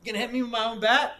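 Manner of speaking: loud, high indignant challenge rising at the end; furious intruder. Retold or imitated. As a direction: imitate a man yelling up in someone's face. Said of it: "So he's furious now".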